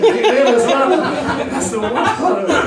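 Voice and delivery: high, loud, slow, laughing through it. Real, not acted. Comic laughing at his own prank story. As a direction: laugh out the punchline.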